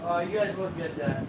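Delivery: usual speaking voice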